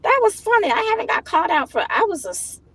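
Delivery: giggly disbelief; amused. Real, not acted. Telling viewers about his insults, high and amused. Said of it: "it had me cracking up laughing".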